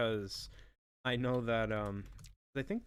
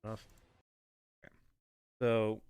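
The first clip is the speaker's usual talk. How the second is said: usual speaking voice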